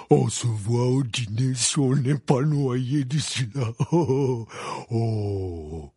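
a lightly voiced giant, slow and booming; hearty greeting through the storm; jovial Hagrid. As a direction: Hagrid waving to Harry.

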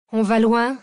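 a loud, grumbling question; Cécile growls at Zach from the passenger seat; growled. A narrator's reading. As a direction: grumble it out, fed up. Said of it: "grogna Cécile".

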